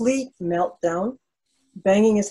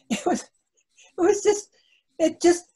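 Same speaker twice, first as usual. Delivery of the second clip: laughing; 10 semitones higher, slower than usual. Real, not acted. Laughing at the memory.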